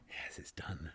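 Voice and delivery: low, barely voiced; whisper. Murderer whispering that the deed is done. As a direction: breathe out the relief. Relieved hushed confirmation.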